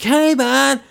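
high-pitched put-on radio buzz; mock excited greeting. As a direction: imitate a falsely excited radio host. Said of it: "like I'm all excited and buzzing".

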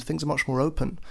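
usual speaking voice

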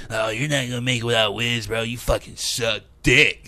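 a hint of a sneering online hater; mock hater voice. Imitating internet trolls mocking him, lightly sneering voice. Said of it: "That's not a good impression of me".

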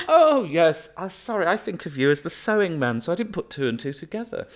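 high, loud fluttery mock recall; imitating Nadine. Minister suddenly remembering him, high loud fluttery mock voice. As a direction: gush it in a high put-on voice. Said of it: "And she went".

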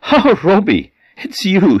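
high, startled gasp of a name; startled fright. Acted. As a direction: caught off guard, voice jumps up high. Man startled awake at night.

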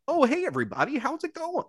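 high, bright host greeting; chirpy show-host hello; presenter voice. Shown as a demonstration. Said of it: "I can't turn it off".

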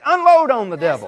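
loud, high preacher's rallying cry; forceful exhortation. Real, not acted. Preacher urging the congregation against the devil, shouted exhortation. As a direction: shout it as a charge to attack.

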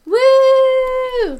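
loud, high whoop of celebration; excited whoop. Real, not acted. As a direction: cheer it out big. Celebrating the episode end.

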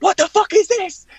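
loud, high-pitched mock rage; seething outburst. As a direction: imitate a furious boss demanding an explanation. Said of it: "seething, ... breathing fire".

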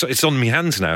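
ordinary talk

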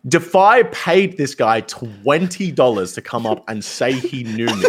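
ordinary delivery

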